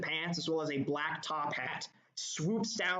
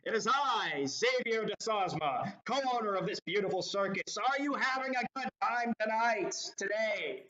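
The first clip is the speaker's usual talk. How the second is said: prim ringmaster; 5 semitones higher than usual. Acted. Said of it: "speaks in a low baritone voice".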